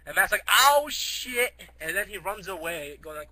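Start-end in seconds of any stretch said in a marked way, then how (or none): 0.5-1.5 high, loud cry of alarm; mock panic. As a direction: yell it as if suddenly spotting a monster. Character panics at tentacled cow.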